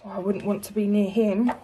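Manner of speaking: ordinary delivery, nothing marked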